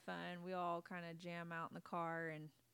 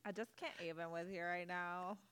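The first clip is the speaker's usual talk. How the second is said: put-on Valley Girl voice. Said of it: "Valley Girl voices".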